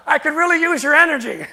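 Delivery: loud, high-pitched mock holler; calling out. Store clerk begs departing customer for his energy, loud high holler. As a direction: belt it out as the eager clerk, hammed up.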